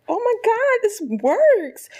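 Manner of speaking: very high, squealed mock amazement; hammed-up thrilled discovery; amazed delight. Drawer suddenly opens for her.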